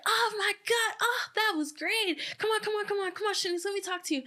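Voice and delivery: high, excited imitation of a gushing host; gushing interviewer. Dancer imitating the interviewer who grabs her after performing, high excited gush. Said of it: "and Shamia's like".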